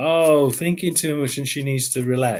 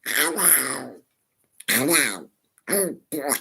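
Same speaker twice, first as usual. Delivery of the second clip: lightly quacky cartoon duck voice; Donald Duck voice; slower than usual. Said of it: "my best Donald Duck impression".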